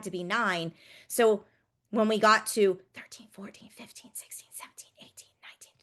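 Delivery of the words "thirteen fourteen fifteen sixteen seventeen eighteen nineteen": unvoiced whispered counting. Shown as a demonstration. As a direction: breathe the numbers in a whisper. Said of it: "we can whisper count".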